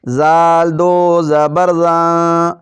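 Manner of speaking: slow, clear, even chanting of syllables; qaida recitation drill. Shown as a demonstration. Teacher drilling children in a steady recitation chant. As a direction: recite each syllable cleanly for the pupils.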